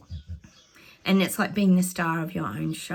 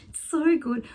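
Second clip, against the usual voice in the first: cheering the news on; delighted exclaim; 9 semitones higher than usual. Real, not acted. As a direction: let the delight burst out. Repeats the delight out loud.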